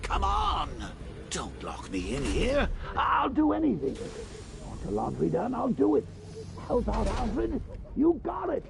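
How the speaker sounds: high, pleading whine, mocking the villain. Player voicing the locked-up villain, begging in mock panic. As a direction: beg in a whiny put-on voice. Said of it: "puts it on to sound scary".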